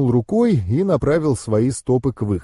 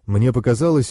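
ordinary delivery

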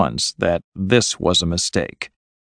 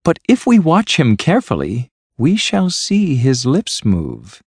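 raised pitch, pleased with the idea; happily predict it, drawing out the ending; happy; 7 semitones higher than usual. A narrator's reading. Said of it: "said Miss Resker happily".

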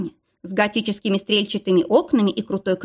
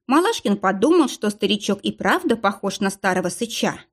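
usual speaking voice

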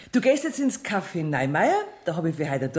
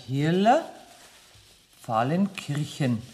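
slow, drawn-out repeat while noting it; slowly spoken to oneself, pen in hand; writing it down; 2 semitones lower, slower than usual. Acted. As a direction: stretch the name out as you write.

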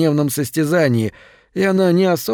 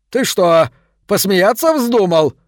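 angry king roar; 6 semitones higher, slower than usual. A narrator's reading. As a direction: roar it down at the stranger. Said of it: "проревел король".

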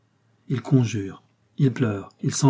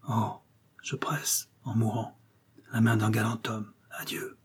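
fading whisper, much softer to nothing; dying whisper; 7 dB quieter than usual. A narrator's reading. Dying marquis bids farewell, whispering to silence. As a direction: breathe out the last words as life goes.

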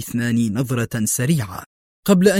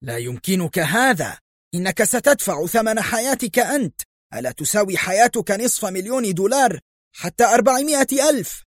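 high-pitched pleading protest, climbing higher; acted frantic disbelief; agitated outcry; 9 semitones higher than usual. A narrator's reading. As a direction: haggle desperately, pitch rising to the end. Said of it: "هتف جوزيه".

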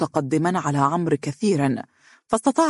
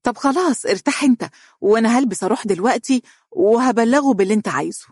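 quick, much higher, eager words; hurried; 7 semitones higher, faster than usual. A narrator's reading. Wife hurrying to leave and report him, quick and high. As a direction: rush it out before he can ask. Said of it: "فقالت بسرعة".

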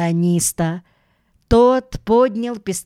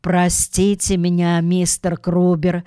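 plain reading voice

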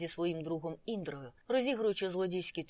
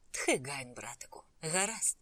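ordinary delivery, nothing marked

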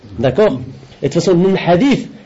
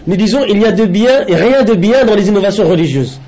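higher-pitched, measured read-aloud in French; deliberate lesson-reading voice, raised in pitch; formal translation reading; 5 semitones higher than usual. Acted. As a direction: read out a translated religious text, phrase by phrase. Reads out French translation of text.

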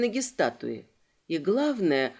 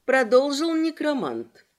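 usual speaking voice

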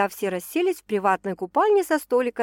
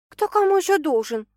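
ordinary delivery

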